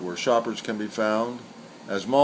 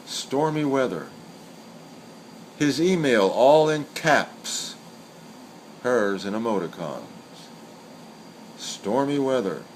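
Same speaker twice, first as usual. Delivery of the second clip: poem recital. Acted. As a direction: let each line of the poem hang before the next. Recites senryu twice.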